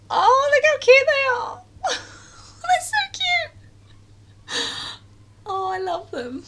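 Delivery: high squealing coo over cuteness. Real, not acted. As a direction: gush at something adorable. Cooing at cute cookies.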